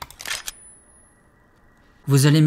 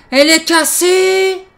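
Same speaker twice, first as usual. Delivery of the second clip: whiny dismay; 14 semitones higher, 8 dB louder, slower than usual. Acted. Flashlight broken, dismayed.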